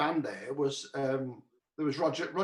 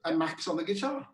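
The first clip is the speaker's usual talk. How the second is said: ordinary delivery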